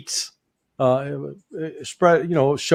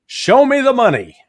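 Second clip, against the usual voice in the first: much higher, louder movie-quote shout; Cuba Gooding Jr. line; 10 semitones higher, 4 dB louder than usual. Retold or imitated. Mocking Washington greed with a higher, louder movie-quote shout. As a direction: belt it out like the Jerry Maguire catchphrase. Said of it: "Cuba Gooding Junior, that actor in the Jerry Maguire movie".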